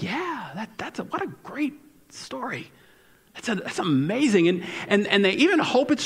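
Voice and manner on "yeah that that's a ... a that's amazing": polite unconvinced. Retold or imitated. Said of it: "they're like".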